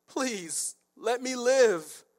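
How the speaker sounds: mock begging for mercy. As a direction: plead for your life, stretching the last words. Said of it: "let's just beg".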